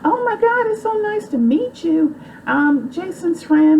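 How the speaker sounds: very high, gushing Southern belle voice; sweet, sky-high gushing over a new friend. Retold or imitated. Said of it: "she's a Southern belle and with all this Southern charm".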